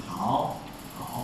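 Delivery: 好 dipped low and soft, each said separately; full third tone. Shown as a demonstration. Teacher giving the correct tone. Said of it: "真正的应该是".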